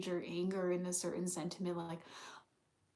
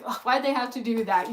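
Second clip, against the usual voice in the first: high, louder exasperated whine; mock irritated whine; 5 semitones higher, 12 dB louder than usual. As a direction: play up the annoyance. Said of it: "that's really irritating to me".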